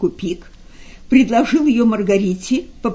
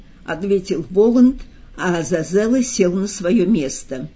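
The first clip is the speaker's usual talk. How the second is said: usual speaking voice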